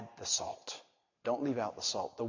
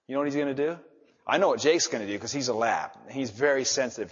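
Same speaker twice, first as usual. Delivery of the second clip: normal talking voice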